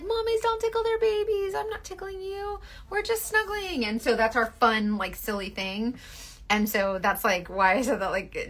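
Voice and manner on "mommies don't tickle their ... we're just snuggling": high sing-song tease; playful denial. Retold or imitated. Mom teasing her son, high sing-song voice. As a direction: coo it with mock innocence.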